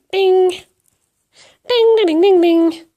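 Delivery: high, loud imitated alert chimes; string of high, ringing dings; repeated phone dings. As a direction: mimic a phone pinging over and over. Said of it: "That was Facebook in my phone".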